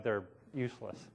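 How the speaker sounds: ordinary talk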